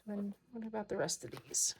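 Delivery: unvoiced whispered aside. Real, not acted. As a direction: whisper the question to yourself. Whispered aside while budgeting.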